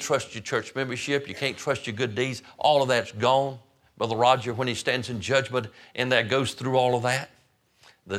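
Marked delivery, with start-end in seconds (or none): none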